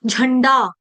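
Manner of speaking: झंडा with the झ pushed out on a puff of air; strongly aspirated झ at the start of झंडा; forced aspirate झ. Shown as a demonstration. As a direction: throw out a breathy झ in झंडा. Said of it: "झंडा नहीं बोलेंगे".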